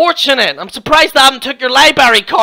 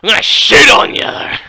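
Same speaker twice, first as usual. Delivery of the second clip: overblown, husky impression of his threats; mocking porn-star voice. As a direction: ham up the threat to mock him.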